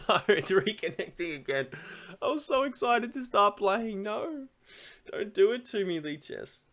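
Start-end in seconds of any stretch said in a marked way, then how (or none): none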